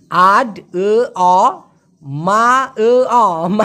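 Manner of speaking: "আড এ অ, মা এ অ" drawn out very slowly; sound-by-sound breakdown. Shown as a demonstration. Teacher breaking the word down for learners, very slow sounds. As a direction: stretch each sound of "আড এ অ, মা এ অ" separately. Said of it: "কীরকমটা হলো?".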